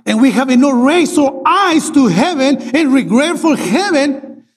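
normal talking voice

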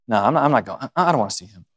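elder son sulking, won't join the party; sulky refusal. Pretend. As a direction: pout and refuse.

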